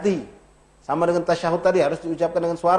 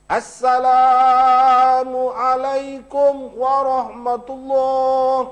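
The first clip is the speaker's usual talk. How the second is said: assalamualaikum warahmatullah very slow, higher and louder, every letter crisp; correct salam; 7 semitones higher, 4 dB louder, much slower than usual. Shown as a demonstration. Ustaz giving the closing salam as an imam would. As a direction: call the salam out slowly like an imam. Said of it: "Ain, bukan a".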